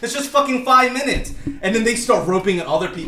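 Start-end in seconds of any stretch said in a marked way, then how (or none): 0.0-1.3 retold heated comeback. Retold comeback in queue quarrel.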